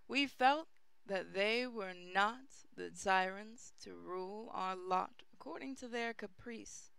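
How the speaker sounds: ordinary delivery, nothing marked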